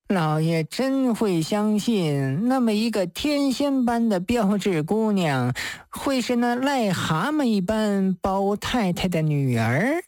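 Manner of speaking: acted drunken slur, words stretched; hazily drunk. Drunk man scoffing to the judge about the girl, hazily drunk. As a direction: roll the words out in tipsy disbelief. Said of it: "宗离醉意朦胧地说道".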